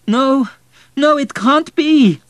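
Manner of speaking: put-on high, loud boy's protest; drawn-out, high-pitched wail of refusal; anguished cry. A narrator's reading. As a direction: cry out in desperate denial. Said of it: "cried Vladik".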